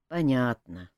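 sighing. A narrator's reading. Cousin accepting the king's explanation, resigned sighing. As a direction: answer with a touch of a sigh. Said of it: "вздохнул в ответ Элмар".

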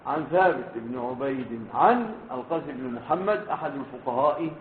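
ordinary talk, nothing marked

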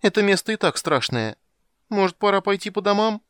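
twitchy squeaking rat voice. A narrator's reading. Rat keeps his squeaky voice.

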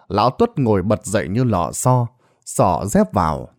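usual speaking voice

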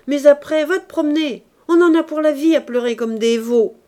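loud, high furious outburst; exploding in anger. Acted. Furious nephew yelling back at the weeping uncle, loud. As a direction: blow up, yelling and fast. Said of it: "hors de lui, éclata".

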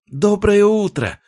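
very high, loud, drawn-out greeting; little girl shouting a gleeful hello; child's shout. A narrator's reading. Little sister bursting in at the door, shouting. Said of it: "закричала Ягоза".

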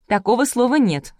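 high, scornful objection; snorted. A narrator's reading. Girl correcting her uncle's word, scornful. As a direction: dismiss it sniffily. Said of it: "фыркнула девушка".

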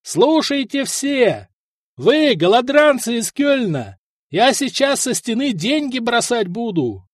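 high, drawn-out shout from the wall; shouted announcement. A narrator's reading. Vyshata on the tower taunting the besiegers' camp, shouting. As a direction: proclaim it slowly to a distant crowd. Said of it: "заорал Вышата с башни".

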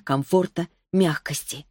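ordinary delivery, nothing marked

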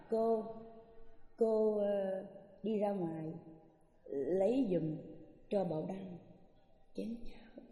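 gentle hushed asking, trailing away; a polite request to the nun, soft. Retold or imitated. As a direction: ask softly and politely for a favour. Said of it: "Đó, mình nói".

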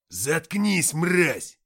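loud, harsh furious shout; angry yell. Acted. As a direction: snarl the insult full force. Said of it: "крикнул Антоха".

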